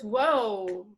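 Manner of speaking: ordinary talk, nothing marked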